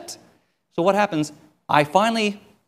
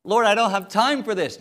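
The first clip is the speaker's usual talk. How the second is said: mock complaining; 5 semitones higher, 5 dB louder, slower than usual. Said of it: "Here I am complaining".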